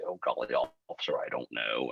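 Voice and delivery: a touch of a meek, low, soft innocent act; mock innocent driver.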